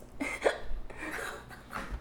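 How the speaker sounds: soft breathy put-on giggle; polite customer-service fake laugh. Shown as a demonstration. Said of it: "The fake laugh".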